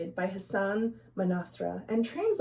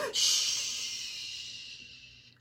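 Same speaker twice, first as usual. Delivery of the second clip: long hushing shh; soft shush; 9 dB quieter than usual. Acted. Parents hushing noisy children. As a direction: hush the children quietly. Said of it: "their parents would say to them".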